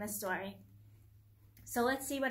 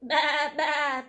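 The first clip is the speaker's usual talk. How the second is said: loud, drawn-out bleating; sheep bleat; 3 semitones higher, 9 dB louder than usual. Acted. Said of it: "bleated the sheep".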